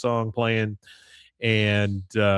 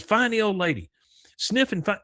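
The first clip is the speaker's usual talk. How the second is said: high-pitched coaxing voice to a pet; woman commanding dog; 11 semitones higher than usual. Retold or imitated. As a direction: imitate an owner urging a dog on.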